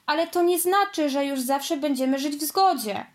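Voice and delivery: ordinary delivery, nothing marked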